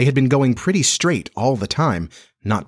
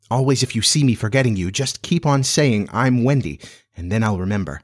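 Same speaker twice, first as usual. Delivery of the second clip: ordinary delivery, nothing marked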